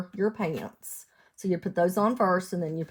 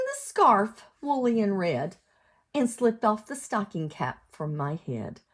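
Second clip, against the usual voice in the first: sing-song storybook read; lilting picture-book reading; reading to children; 4 semitones higher, 5 dB louder than usual. Acted. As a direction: read the rhyme out to little ones. Cumulative rhyme read aloud.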